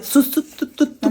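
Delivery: talking through a blocked nose; words stuck behind a stuffy nose. Real, not acted. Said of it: "नाक बंद झालंय".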